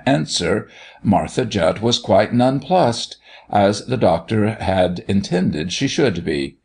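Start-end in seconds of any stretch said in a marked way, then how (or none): none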